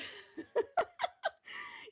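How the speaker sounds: high, breathless villain cackle; maniacal laugh. Real, not acted. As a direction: cackle like a supervillain. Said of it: "I might have a maniacal laugh".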